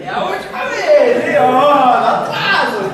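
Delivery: hammed-up nervous Abraham, half laughing; imitating Abraham urging God through laughter; laughing mock Abraham. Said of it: "eu imagino Abraão assim".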